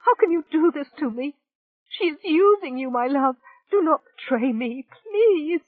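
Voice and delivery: high, loud frantic begging; hysterical pleading. Acted. Rachel begging her love not to betray her. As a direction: plead wildly, near a scream.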